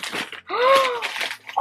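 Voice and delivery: high, sharp gasp of delight. Real, not acted. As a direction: suck in breath with delighted surprise. Delighted at opening a gift.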